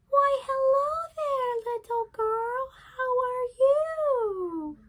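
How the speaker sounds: high, slow put-on granny voice; a wolf squeaking like a sweet old granny; wolf faking grandmother. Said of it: "disguise himself as the grandmother".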